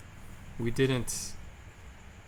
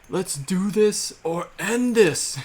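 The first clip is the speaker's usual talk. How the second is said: put-on loud, high, drawn-out drunken challenge; drunken scream; 8 semitones higher, 9 dB louder, slower than usual. Comedian imitating drunk guy screaming at someone in Waikiki, loud challenge. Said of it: "he was screaming at someone".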